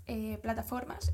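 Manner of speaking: ordinary delivery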